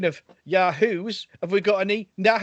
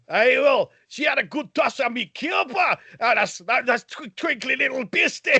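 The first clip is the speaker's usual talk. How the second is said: Jamie McCrimmon Scottish voice; 6 semitones higher than usual. Retold or imitated. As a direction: rattle it off in broad Scots. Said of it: "he's clearly rehearsed that".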